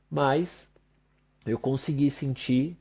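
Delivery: normal talking voice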